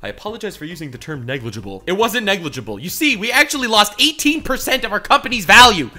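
mock CEO apology. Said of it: "the C E O's apology video was".

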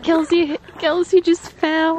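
high, loud and slow; excited laughing. Real, not acted. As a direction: call it out through laughter. Laughing at friend's fall.

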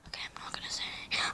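very soft, fast whisper; whispered aside. Real, not acted. Player promising to keep quiet, hushed fast whisper. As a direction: whisper it quickly under your breath. Player vows silence under breath.

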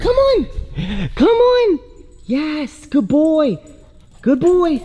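high, loud sing-song praise for the puppy; dog praise voice. Real, not acted. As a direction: cheer the dog on like a proud parent. Owner praising puppy to poop outside.

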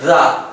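"Giờ" as a lighter voiced th sound; "Giờ" lightly buzzed, no heavy stop; not heavy. Shown as a demonstration. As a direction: say "Giờ" without leaning heavily on it. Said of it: "Không đọc nặng thế đâu".

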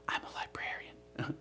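hushed, whispered deadpan answer; quoted Vine reply. Retold or imitated. Answering the admirer's question, whispered. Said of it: "uh, it's like".

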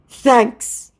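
high, loud mock thanks; hammed-up gratitude; imitating scammer. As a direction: pipe it up in a put-on voice. Voices scammer's message.

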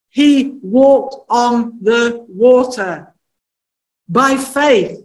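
He walked on the water, drawn out very slowly; slow emphatic repeat. Shown as a demonstration. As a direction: stretch every word of He walked on the water. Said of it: "And he stepped out of the boat and he walked on the water".

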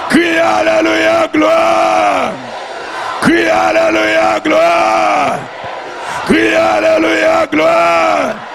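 high, sustained chanted shout; praise cry. Real, not acted. Preacher leading the cry of the saved, chanted shout. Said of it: "c'est le cri des sauvés".

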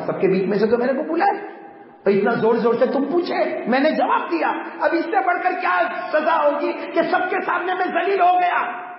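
high, climbing protest; acted wailing complaint, pitched very high; aggrieved outcry. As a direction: cry out the humiliation to God. Humiliated man protests to God.

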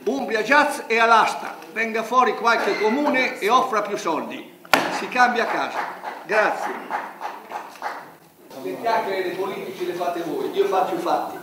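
furious shouting, voice rising; explosive italian cursing. Real, not acted. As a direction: explodes in real outrage, loud. Furious protest at press conference. (sfogo furioso, urlando)

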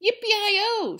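loud, high cowboy yell; shouted yippee. A narrator's reading. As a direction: whoop like riding off. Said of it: "shouted Leroy Ninker".

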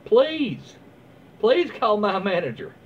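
whiny call-the-manager voice; mock demanding customer. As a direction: ham up the entitled shopper. Imitating the angry customer.

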